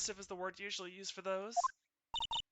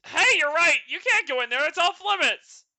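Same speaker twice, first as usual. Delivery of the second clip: raised, higher-pitched protest; loud objection; 7 semitones higher, 11 dB louder than usual. Acted. Character stops visitors going in there, loud protest. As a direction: call out loudly to stop them. Acted character forbidding entry in game.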